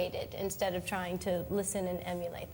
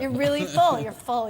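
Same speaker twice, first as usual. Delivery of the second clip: louder, stressing full; louder, exasperated stress on full; emphatic; 4 semitones higher, 10 dB louder than usual. Real, not acted. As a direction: insist how stuffed you are. Describes being forced to eat.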